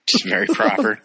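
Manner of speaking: high, laughing proper puppet voice; prim eagle puppet, said through a laugh; mock Sam Eagle. Joking about the actor's voice in a prim puppet tone. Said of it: "sound more like Sam Eagle".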